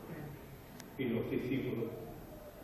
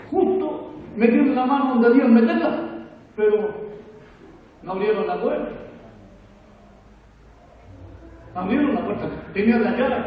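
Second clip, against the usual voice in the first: loud, fired-up preacher's cry; preaching at full force; 6 semitones higher, 10 dB louder than usual. Acted. Pastor calling out to his church, loud and fervent. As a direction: hammer it home to the congregation. Preacher driving home a point.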